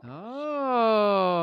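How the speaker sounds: high, drawn-out "oh" of surprise; surprised. Real, not acted. Host reacts to a player's Toy Story pick, surprised. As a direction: let a long, high oh slide down. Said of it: "I'm surprised".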